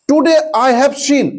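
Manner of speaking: each word spaced out for copying; slow dictation. Shown as a demonstration. Teacher dictating a sentence to students, very slowly. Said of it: "দেখো".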